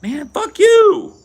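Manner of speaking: hammed-up kid snapping back; mock teen comeback. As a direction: whine it like an offended twelve-year-old. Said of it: "We'd be like".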